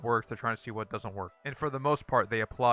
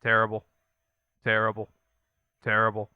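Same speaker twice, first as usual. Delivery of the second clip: blunt verdict; 7 dB louder than usual. Real, not acted. Rating loot rolls one by one.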